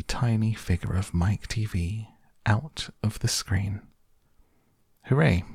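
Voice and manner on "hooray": higher, triumphant cheer; joyful cry. A narrator's reading. Wonka seeing the boy arrive whole, joyful cry. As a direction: cheer it out with relief. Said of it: "cried Mister Wonka".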